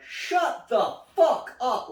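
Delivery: slow, high, loud reprimand to a friend; loud retold yell. Drunk skater to his friend in front of a cop, loud yell. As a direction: bellow it out, drawn out and high. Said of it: "like, loud".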